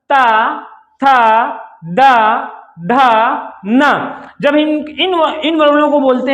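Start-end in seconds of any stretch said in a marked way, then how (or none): 0.1-4.2 "था, दा" stretched slowly with the tongue at the teeth; touch the teeth and draw out "ता", "था", "दा"; dental demo. Shown as a demonstration. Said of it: "देखो ता". (दाँत से धीमा उच्चारण)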